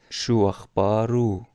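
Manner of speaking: شو اخبارو ending in a long "u", slow; absent man. Shown as a demonstration. Teacher modelling asking after an absent man, slowly. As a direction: say شو اخبارو as "shu akhbaru". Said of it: "این او برمیگرده به اون آقاه".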